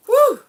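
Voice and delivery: loud high whoop; sudden thrilled woo; excited whoop. Real, not acted. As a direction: cheer out loud with delight. Excited reaction to a card.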